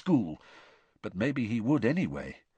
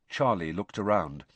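usual speaking voice

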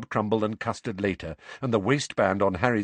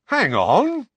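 high, loud cut-in; loud protesting George; loud interruption; 14 semitones higher, 9 dB louder than usual. A narrator's reading. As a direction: cut in sharply. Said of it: "interrupted George loudly".

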